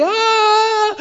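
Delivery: very high, loud drop-ride scream; thrill-ride scream. Pretend. Riding the free-fall at Six Flags, high loud scream. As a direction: shriek as the ride drops.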